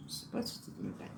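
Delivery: breathy whisper to herself, as an aside. Real, not acted. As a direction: drop to a whisper, musing alone. Repeats doubt under her breath. (chuchoté pour soi, en aparté)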